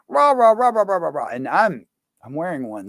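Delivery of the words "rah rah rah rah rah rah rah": mock protester ranting. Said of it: "And they would say".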